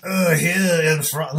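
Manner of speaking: slow put-on French accent; false start in a French accent; mock French accent. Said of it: "let me do a French".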